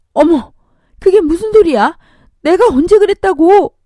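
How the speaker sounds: high, loud indignant outcry; shocked denial. Acted. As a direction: object loudly, voice pitched right up. Mother denies the false claim. (기가 막혀 따지는 목소리)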